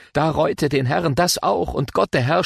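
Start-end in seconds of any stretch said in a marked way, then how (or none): none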